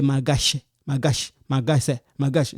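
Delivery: "malgache" said without its l, as "magache"; dropped L. Shown as a demonstration. Teacher repeating the mistake to avoid, L left out. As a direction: skip the l in "malgache" each time: ma-gache. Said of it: "You don't say malgache".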